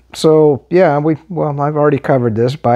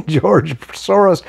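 laughing; 5 semitones higher, slower than usual. Real, not acted. Laughs at Soros clip.

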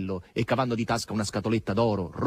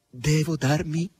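higher, halting question; pensive; 7 semitones higher, slower than usual. A narrator's reading. Margherita guesses what is asked of her, pensive. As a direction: wonder aloud, pausing midway. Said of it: "disse pensierosa Margherita".

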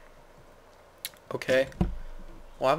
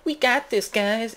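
high-pitched hero taking a dare; rally the team in a playful high voice; hammed-up, eager challenge; mock bravado; 9 semitones higher, 4 dB louder, slower than usual.

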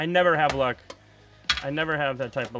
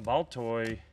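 ordinary talk

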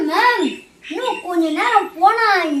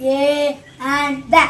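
split up slowly; 5 semitones lower, much slower than usual. Shown as a demonstration. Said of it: "நிறுத்தி பொறுமையா சொல்றா".

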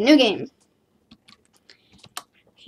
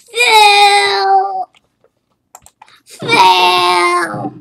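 higher, louder drawn-out yell; mock fail shout; 7 semitones higher, 6 dB louder than usual. Real, not acted. Gamer announcing his ragdoll failed, loud mock shout. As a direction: announce the failure like a buzzer.

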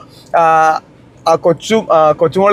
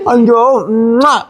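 high, very slow coo into a kiss; doting kiss; 5 semitones higher, much slower than usual. Real, not acted. Uncle kissing his little nephew, doting baby talk.

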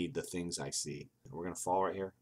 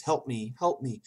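lightly acted moan, pitched higher; a touch of ghostly pleading; moaning far-off voice; 5 semitones higher, 5 dB louder than usual. A narrator's reading. Narrator voices a far-off cry for help, light moan. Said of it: "A far-off voice moans".

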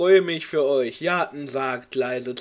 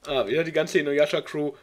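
usual speaking voice

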